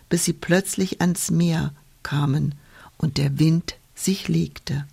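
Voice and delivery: usual speaking voice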